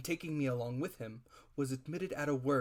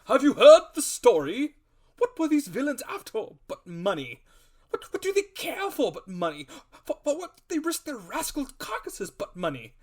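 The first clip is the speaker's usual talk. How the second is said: high, loud exclamatory tirade; the squire harangues the doctor in a loud, high rant; hot-headed ranting; 12 semitones higher, 12 dB louder than usual. A narrator's reading. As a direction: rant on, louder and higher. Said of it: "cried the squire".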